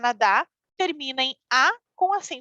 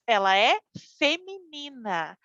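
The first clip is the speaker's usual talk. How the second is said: usual speaking voice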